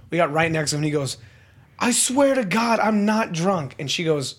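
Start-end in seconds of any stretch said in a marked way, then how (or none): 1.8-3.7 retold yelling. Drunk traveler swears to airline staff, high mock yell. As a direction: imitate a drunk insisting, slow and high. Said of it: "we could hear him yelling".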